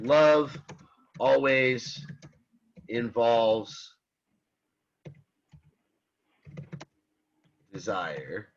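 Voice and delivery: each word spaced out with pauses; slow emphatic statement. Shown as a demonstration. Professor stressing the definition, slow and spaced. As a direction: lay out the key phrase word by word. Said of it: "to emphasize that".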